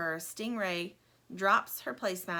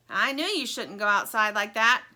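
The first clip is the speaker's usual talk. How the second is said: louder scolding call; calling from window; 8 dB louder than usual. Acted. Stingray scolds Lumpy from the window, loud call. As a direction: call it down from the window, told-you-so. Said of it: "calls Stingray from the window".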